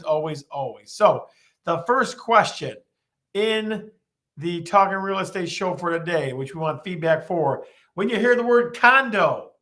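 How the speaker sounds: ordinary talk, nothing marked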